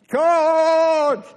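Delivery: high, very loud drawn-out war cry; battle-cry shout. Acted. Preacher playing a soldier charging into battle, full shout. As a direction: bellow it like leading troops into battle.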